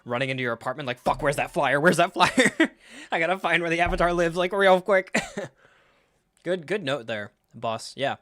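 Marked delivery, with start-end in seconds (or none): 1.0-5.2 louder, frantic acted panic; mock frantic. Someone searching their apartment for a flyer, mock frantic. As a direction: play someone searching in a flap. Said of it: "imagine how frantic that must have been".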